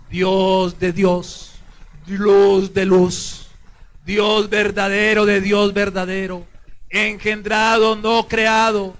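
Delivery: loud, very slow, measured proclamation; creed recitation. Real, not acted. Priest leading the faithful in the creed, slow loud recitation. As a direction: lead the congregation phrase by phrase.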